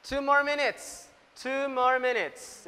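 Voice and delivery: teacher calling out the time limit; calling out to class. Real, not acted. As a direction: announce the time left so every group hears. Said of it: "calling out time to the class".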